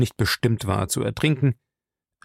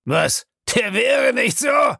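high, drawn-out angry shout; slow, irritated outburst; annoyed; 12 semitones higher, 3 dB louder, slower than usual. A narrator's reading. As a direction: snarl the question in disbelief. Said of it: "fragte er ärgerlich".

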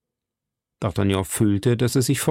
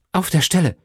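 higher, emphatic exclamation; calling out; 5 semitones higher than usual. A narrator's reading. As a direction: call it as an immediate command. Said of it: "Milady wollte rufen". (ausrufend, gebieterisch)

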